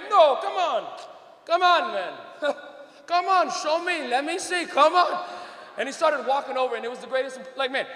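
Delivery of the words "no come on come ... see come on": eager dad voice, higher and insistent; imitated father. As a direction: pester like an excited Dad. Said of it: "And he went".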